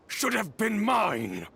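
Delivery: sudden indignant shout; resentful outburst. Acted. Villain claiming the role was owed, indignant outburst.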